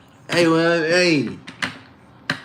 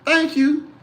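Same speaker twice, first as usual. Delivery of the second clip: polite mama; 5 semitones higher, slower than usual. Retold or imitated. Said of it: "she was like".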